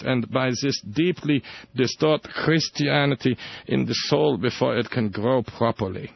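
slow, soft German accent. Shown as a demonstration. Host redoing the Ratzinger quote, slow German accent. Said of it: "Wait a minute, wrong accent".

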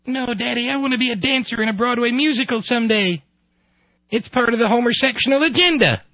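put-on squeaky high child voice; little boy. Son tells father his dream, little-boy voice. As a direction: pipe it up like a small son.

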